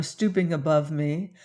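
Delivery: ordinary delivery, nothing marked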